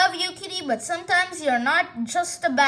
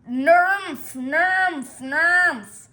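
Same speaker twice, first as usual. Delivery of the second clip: loud grumpy nerf noises; cat grumble; 4 dB louder, much slower than usual. Acted. Sulky kitty grumbling at the baby, loud.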